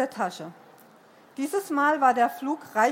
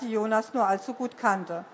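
ordinary delivery, nothing marked

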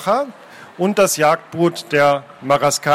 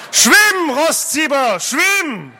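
high, drawn-out yell across the water; urgent shout; 11 semitones higher, 3 dB louder, much slower than usual. Acted. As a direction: yell to a swimmer in danger. Urging swimmer away from shark.

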